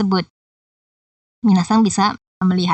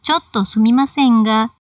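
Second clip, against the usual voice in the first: "ga" held at the same pitch as "sumimasen"; level trailing intonation. Shown as a demonstration. Teacher demonstrates the three-dot mark, level intonation on "ga". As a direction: leave "ga" hanging level after "Chotto sumimasen". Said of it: "untuk partikel ga diucapkan dengan ketinggian".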